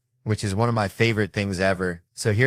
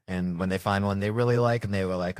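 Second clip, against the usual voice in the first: normal talking voice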